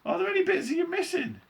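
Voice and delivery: shrill, loud, worried mother; very high panicked cry. A narrator's reading. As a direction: shriek it anxiously. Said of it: "cried Missus T V".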